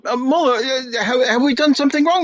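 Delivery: a touch of a nervous father; anxious host. Acted. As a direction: fret that you've given offense. Father alarmed again.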